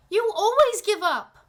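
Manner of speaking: put-on high, shouting little girl; angry girl yelling at her father; shouted. A narrator's reading. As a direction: hurl it at him as a child. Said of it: "I shouted".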